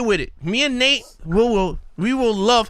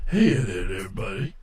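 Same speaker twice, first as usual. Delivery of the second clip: much lower, deep, smooth greeting; deep barbecue voice; 7 dB quieter than usual. Retold or imitated. Host imitating a friend's deep smooth voice. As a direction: sink your voice way down, smooth. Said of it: "He goes".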